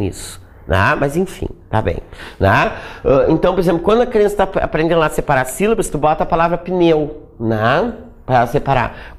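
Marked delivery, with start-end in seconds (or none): none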